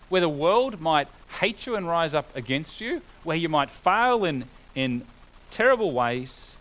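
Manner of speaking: rising preacher's fervour; preaching at full force. Acted. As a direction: proclaim it to the congregation.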